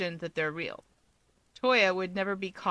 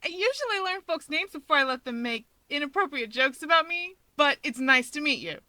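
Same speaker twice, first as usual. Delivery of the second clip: put-on higher, drily polite young man; wry hero voice; 7 semitones higher than usual. A narrator's reading. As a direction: politely needle her back. Voices Hawks, dry and polite.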